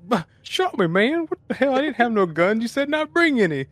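high, hammed-up wounded disbelief; mock surprised whine. Mocking the shot villain's surprise: high hammed-up whine. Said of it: "as surprised as we are".

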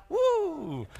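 a quick high whoop of joy; excited cheer. Real, not acted. Pastor whoops to the congregation, high and excited. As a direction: whoop at the word shout. Cheer after reading "shout aloud.

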